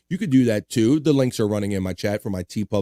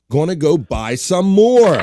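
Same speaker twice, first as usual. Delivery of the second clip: hyped read-out; 5 semitones higher, 7 dB louder, slower than usual. Acted. Acting out a chat message.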